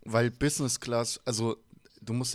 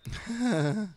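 high laugh; 11 semitones higher, 4 dB louder than usual. Real, not acted. Lacht über Whisky-sauer-Witz. (hohes Auflachen)

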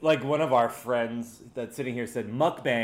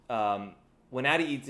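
ordinary talk, nothing marked

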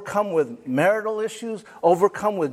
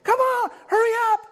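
high, fast impatient call to hurry; a mock-frantic hurry-up, high and quick; faster than usual. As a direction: hurry the late one along, pitched way up. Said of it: "You're going".

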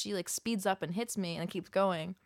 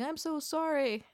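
put-on old lady apologizing; 8 semitones higher, slower than usual. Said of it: "and was like".

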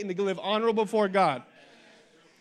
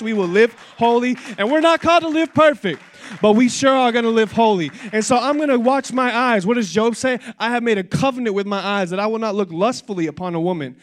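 loud, fervent declaration to the congregation; full-force preaching; 3 semitones higher, 10 dB louder than usual. Acted. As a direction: drive it home like a revival preacher.

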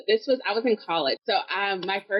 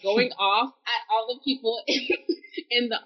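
usual speaking voice

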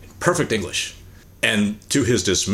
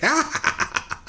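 high breathy mock gasp; a shocked little intake of air; stunned gasp; 3 dB quieter than usual. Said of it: "I was just like".

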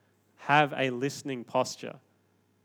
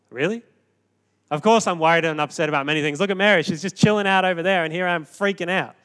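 loud, fast, exasperated retort; exasperated outburst; 4 semitones higher, 8 dB louder than usual. Retold or imitated. Martha snapping back at Jesus, loud and exasperated. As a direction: fire it back, fed up and frazzled. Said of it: "I'd put on my hand and go".

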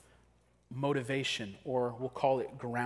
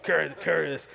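louder, gruff bossy soldier; Roman soldier voice; 3 semitones higher, 7 dB louder, faster than usual. Retold or imitated. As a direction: bark orders at a peasant. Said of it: "I'm gonna try to do a Roman soldier voice".

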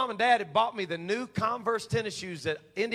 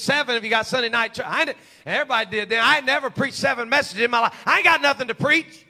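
ordinary talk, nothing marked

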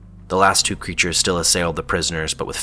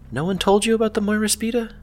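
much higher, taunting voice; mocking female voice. A narrator's reading. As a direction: sneer it at her. Feza taunts the priestess.

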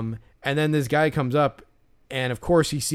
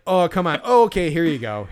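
higher, louder sarcastic imitation; hammed-up sudden surrender; mock giving in; 5 semitones higher, 6 dB louder than usual. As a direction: pretend the plea instantly won you over.

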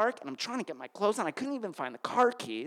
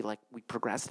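ordinary delivery

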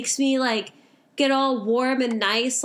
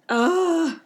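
disgusted groan; 4 semitones higher than usual. Retold or imitated. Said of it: "I was just like".

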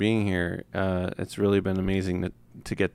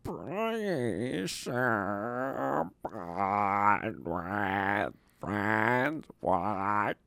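troll voice; much slower than usual. Acted. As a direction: stretch each word like a clumsy troll. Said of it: "best troll voice".

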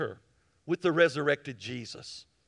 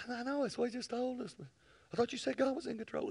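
higher, lightly put-on voice; retold reply; 8 semitones higher, 7 dB quieter, faster than usual. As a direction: answer with quick gentle faith. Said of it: "I said".

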